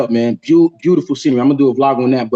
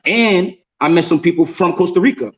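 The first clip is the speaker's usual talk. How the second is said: usual speaking voice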